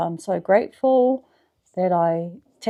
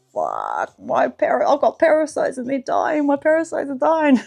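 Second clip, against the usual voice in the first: higher, excited retelling of the panic; mock alarm; 6 semitones higher than usual. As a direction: act out a half-mock freak-out. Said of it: "I was like".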